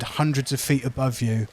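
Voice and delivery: ordinary talk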